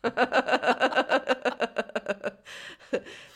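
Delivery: high giggling burst; laughing. Real, not acted. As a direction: laugh it out at your own joke. Laughing at own joke.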